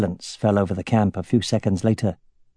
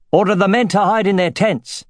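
shouting; 9 semitones higher than usual. A narrator's reading. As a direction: bark the order across camp. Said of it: "he shouted".

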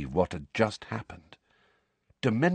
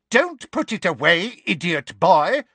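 put-on high, loud old-woman shriek; 9 semitones higher, 6 dB louder than usual. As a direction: shriek it in a panic. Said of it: "she shrieked".

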